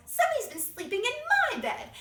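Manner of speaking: high, loud, indignant bear voice; Mama Bear. Acted. Mama Bear finding her bed slept in, high-pitched outrage. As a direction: exclaim it way up high. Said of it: "Mama Bear goes".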